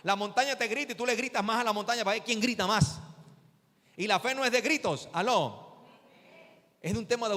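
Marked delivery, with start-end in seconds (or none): none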